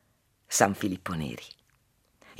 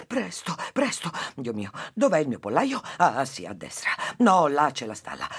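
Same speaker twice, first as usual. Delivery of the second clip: frantic housewife; 5 semitones higher than usual. Acted. Housewife panics searching coop.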